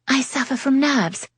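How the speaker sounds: put-on high, fussy old lady. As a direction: play a nervous fussy lady. Lady Lydia voiced, much higher.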